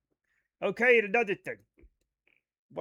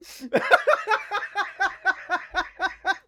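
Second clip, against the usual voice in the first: high laugh; 10 semitones higher, 3 dB louder than usual. Real, not acted. Laughs at own joke.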